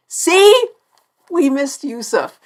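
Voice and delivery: hammed-up caps-lock cry of see; mock excited shout. Quoting the episode's all-caps line.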